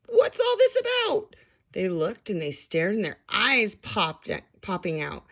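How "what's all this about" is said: very high, loud crowd voice; crowd shouting. A narrator's reading. Crowd rushing in, very high shout. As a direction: shout it as an amazed townsperson. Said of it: "people came shouting".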